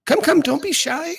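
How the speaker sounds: a high eager hostess seating her guests; welcoming hostess voice. Acted. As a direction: usher everyone to the table with a bright lilt. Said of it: "she beckons you".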